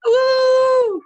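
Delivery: high whooping shout of support; big cheering woo from the audience; excited cheer. Real, not acted. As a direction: whoop it up loud for her. Cheering on the next poet.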